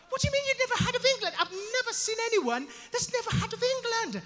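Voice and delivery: high, fast indignant protest; incredulous English lady. Acted. English lady to man who never heard of England, incredulous. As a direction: sputter in high-pitched English disbelief. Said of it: "She couldn't believe it".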